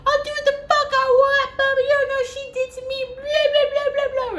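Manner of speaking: very high-pitched mock screaming rant; imitated going off. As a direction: screech like a man ranting at security, hammed up. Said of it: "he starts going off at them too".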